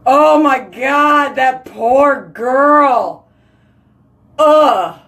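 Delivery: pained outcry. Real, not acted. Reacting to sad story.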